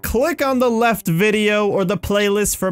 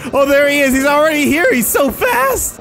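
high, loud alarmed cry; frantic, climbing to a squeal; panicked alarm; 7 semitones higher, 4 dB louder than usual. Pretend. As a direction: cry out as the pursuer closes in. Chaser suddenly arrives.

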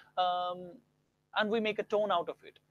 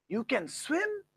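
higher surprised "You can swim?"; 8 semitones higher, much slower than usual. Shown as a demonstration. Teacher shows surprise, higher pitch. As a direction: sound surprised, lifting "swim".

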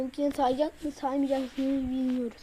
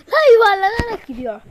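loud, high amazed yell; excited shout; 7 semitones higher, 12 dB louder than usual. Real, not acted. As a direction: shout it out in disbelief. Car rolling, shouting.

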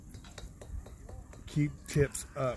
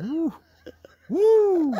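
two high whoops, the second louder and longer; 12 dB louder than usual. Real, not acted. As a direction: whoop out as the ride sways. Rider reacting to swaying chairlift.

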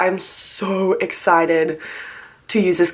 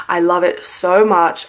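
ordinary delivery

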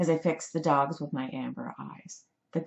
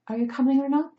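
high, louder prodding question; impatient young woman; 8 semitones higher, 5 dB louder, faster than usual. A narrator's reading. Rescuer hurrying George along, impatient young woman.

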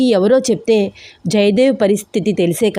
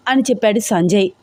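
ordinary delivery, nothing marked

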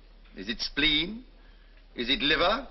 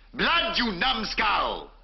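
furious shout; 7 semitones higher, much slower than usual. Acted. Surgeon to fumbling student, a slow furious shout. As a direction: blast it at the dim student, slowly.

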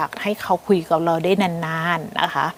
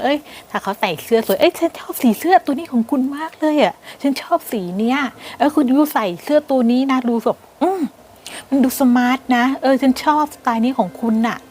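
higher, gushing admiring voice; sweet compliment; 5 semitones higher than usual. Acted. Girlfriend praising her foreign boyfriend's shirt, higher and gushing. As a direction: gush over his shirt like you adore it. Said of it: "ชมในสิ่งที่เขามีนะคะ ว่า".

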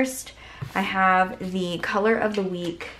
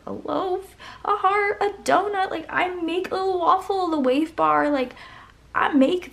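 excited squeal; 11 semitones higher than usual. Real, not acted. Recognising pieces she makes herself.

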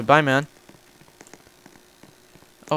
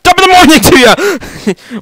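high-pitched, drawn-out holler; goofy high yelled greeting; sudden yell; 11 semitones higher than usual. Pretend. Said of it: "why did I yell out that".

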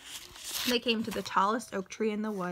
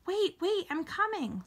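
high, urgent call to wait; hurried, high-pitched plea; calling after someone; 10 semitones higher than usual. A narrator's reading. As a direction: call out high to stop her leaving. Jack calls after departing sister.

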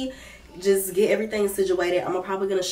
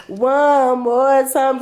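slow drawn-out hype; 4 semitones higher, 4 dB louder, much slower than usual. Real, not acted. Hyping herself for next outfit.

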